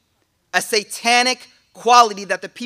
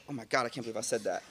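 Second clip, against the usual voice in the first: cringing mutter; 12 semitones lower, 16 dB quieter, much faster than usual. Retold or imitated. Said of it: "And you just like".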